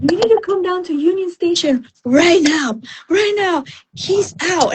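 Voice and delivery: high-pitched breaking news over the phone; excitedly imitating a friend's frantic call; retold urgent excitement. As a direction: act out an urgent summons.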